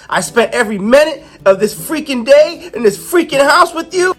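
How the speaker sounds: venting outburst. Acted. Ro unleashes pent-up rage.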